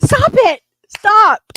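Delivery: very high, loud shout; real scolding yell. Real, not acted. Owner to cat eating something, high yelled scolding. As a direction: yell at the cat to quit right now.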